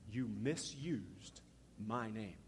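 slow, low, soft pronouncement; grave rebuke. Retold or imitated. God to Moses after he hit the rock, grave acted rebuke. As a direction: let each word fall slowly and softly.

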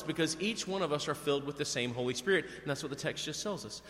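ordinary talk, nothing marked

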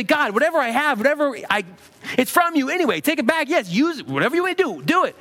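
high, insistent offering to God; eager surrender. Acted. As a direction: act out gladly giving it all back. Voicing willing surrender to God.

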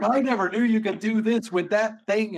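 imitating an awed crowd; amazed kids. Kids amazed at knife demo.

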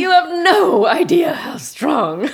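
loud, high, drawn-out boast; slow, emphatic and thrilled; giddy gushing. Acted. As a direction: swoon loudly over him. Topping daughters' teasing comparisons.

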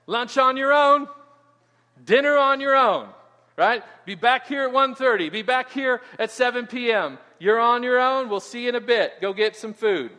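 high, loud mock announcer calling out the schedule; conference announcer. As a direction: play the loud emcee sending everyone off to eat.

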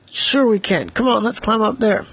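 rally a friend to climb along; eager urging. Acted. Boy eagerly urges his friend.

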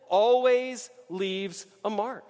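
very slow, each word weighted; slow refrain. Acted. Preacher pressing the refrain home, very slow. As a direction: lean on every word of the refrain.

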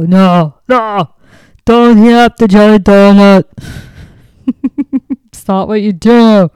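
put-on loud Bigfoot with a mangled action-hero accent; Arnold Schwarzenegger. Bigfoot objecting to heated donuts, loud put-on bellow. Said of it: "like a mixture of Arnold and Schwarzenegger and somebody".

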